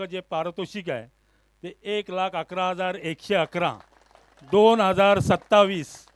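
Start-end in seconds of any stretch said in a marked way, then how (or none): 4.4-6.1 loud, slow, punched-out year; emphatic announcement. Acted. Announcer proclaiming next year's tournament to the crowd, loud and emphatic. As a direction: proclaim the year loudly and slowly.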